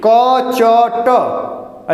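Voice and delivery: bold, clear consonants, shown as the right way; say it loudly. Shown as a demonstration. Teacher showing shy students the correct, loud delivery. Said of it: "जोर से बोलो".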